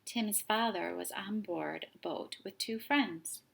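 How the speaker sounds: ordinary delivery, nothing marked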